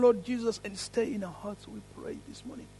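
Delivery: ordinary delivery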